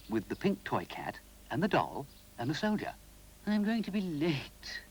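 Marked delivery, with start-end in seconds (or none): 3.5-4.8 snooty. A narrator's reading. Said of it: "the toy cat said snootily".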